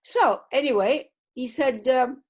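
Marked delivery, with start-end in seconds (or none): none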